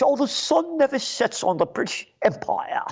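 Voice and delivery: high, hammed-up imperial pride; sarcastic parody of British imperial boast; mock pompous. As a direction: recite the slogan like a smug colonialist. Mocking imperial slogan.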